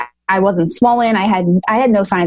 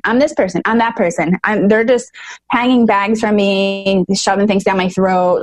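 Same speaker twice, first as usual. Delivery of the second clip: ordinary talk, nothing marked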